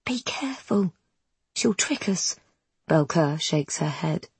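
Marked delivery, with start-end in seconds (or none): 0.1-2.3 soft unvoiced warning whisper. Wren warns in whispers.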